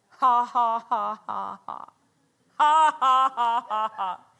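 slow, deliberate "ha ha ha", each one dropping; forced mock laugh. Preacher acts out a sick man laughing at symptoms, forced laugh. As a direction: imitate a forced laugh in spaced, punched ha's. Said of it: "he starts laughing".

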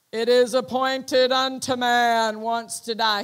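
loud, booming pronouncement; dramatic voice. Retold or imitated. Voice in his head announcing death, loud and dramatic. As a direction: proclaim it like a voice from heaven. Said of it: "He said it was very dramatic".

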